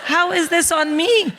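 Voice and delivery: high, drawn-out mock indignation; incredulous protest. Mother blamed by her daughter, incredulous. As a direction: protest your innocence, high and slow.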